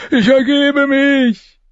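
very high, slow drawn-out cry; loud high-pitched wail of surrender; panicked surrender. A narrator's reading. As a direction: cry out in terror, stretching the words. Panicked character jumps up, surrendering.

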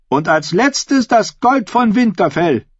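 high-pitched, forceful oratory to a hall; defiant female claimant. Acted. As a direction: challenge the crowd like a contender for the crown. Asha presents mock treasure.

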